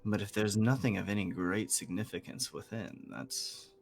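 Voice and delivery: stately bird, mildly let down; gargantuan bird, disappointed. Acted. Game master voices giant bird.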